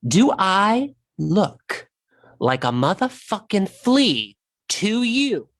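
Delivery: slow, deliberate words, each one spaced out; very slowly. Acted. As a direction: spell it out slowly like a threat. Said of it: "I'm gonna say this very slowly".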